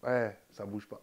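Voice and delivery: tossing it off like a guy who's chilling; mock street slang. Mocking the lads' stock answer, a touch of street voice. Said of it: "le fameux, ça dit quoi?".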